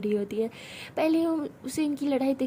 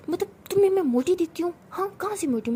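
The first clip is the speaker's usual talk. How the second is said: outraged question; 7 semitones higher than usual. Acted. Hamara, insulted about her weight, shouting indignantly. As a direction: hurl the question back in outrage.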